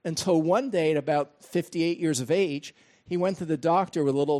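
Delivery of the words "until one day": usual speaking voice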